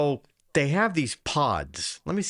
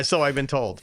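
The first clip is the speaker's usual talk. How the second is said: ordinary talk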